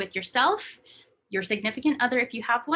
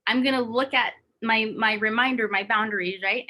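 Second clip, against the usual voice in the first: ordinary delivery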